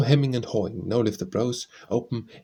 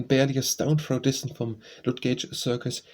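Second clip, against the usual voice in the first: plain reading voice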